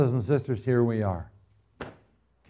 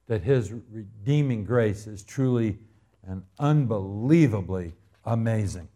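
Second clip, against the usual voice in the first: usual speaking voice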